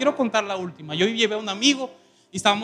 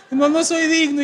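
crying refusal; 8 semitones higher, 5 dB louder than usual. Retold or imitated. Same crying refusal again.

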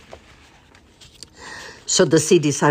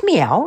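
cat meow; 6 semitones higher, 4 dB louder than usual. Acted. Imitating a cat.

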